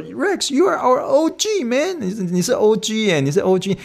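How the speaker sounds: very high, stretched praise; hyped praise. Retold or imitated. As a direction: gush like a proud buddy. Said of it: "被他说".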